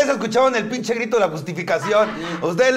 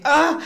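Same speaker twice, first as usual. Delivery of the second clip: a short laughing grunt, a bit higher; demo grunt; 8 semitones higher than usual. Shown as a demonstration. Showing friends how she moans in bed, a short laughing grunt. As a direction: show your bedroom groan, half laughing. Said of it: "sí pujo así".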